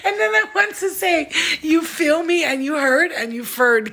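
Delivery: giggling, high and bright with laughter; laughing retelling. Real, not acted. Vlogger laughing at her own word mix-up, laughing. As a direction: laugh through the slip of the tongue. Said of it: "came out".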